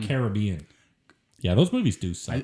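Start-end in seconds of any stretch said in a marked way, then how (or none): 0.0-0.7 Caribbean as ka-ruh-BEE-un; Caribbean with the BEE stressed, low; preferred pronunciation. Shown as a demonstration. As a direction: say Caribbean stressing its third syllable. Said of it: "I pronounce".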